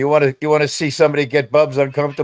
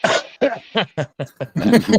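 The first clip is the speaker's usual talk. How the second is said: helpless high-pitched giggling; high laugh; 9 semitones higher than usual. Real, not acted. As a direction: crack up at a silly suggestion. Cracks up at mud wrestling idea.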